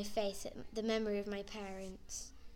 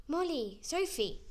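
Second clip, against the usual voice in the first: calling out; 6 semitones higher than usual. Acted. Calling out the children's names.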